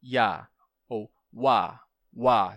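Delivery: ya and wa drawn out slowly; demo approximants. Shown as a demonstration. Teacher demonstrating approximants slowly drawn out. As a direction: glide slowly into ya and wa. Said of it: "como ya".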